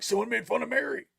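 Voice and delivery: high, rising tone of protest; mock indignation. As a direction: raise the pitch in playful outrage. Acts out his own indignant reply.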